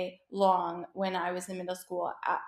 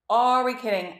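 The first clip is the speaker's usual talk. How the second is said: Are punched louder, falling; louder disbelief; 2 semitones higher, 7 dB louder than usual. Acted. Vlogger to viewers, louder mock disbelief. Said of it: "repeated louder".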